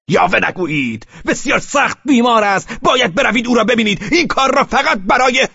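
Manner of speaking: loud, high furious shouts; shouting. A narrator's reading. Velchaninov yells at Pavel about his dying daughter. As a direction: hurl each phrase at him.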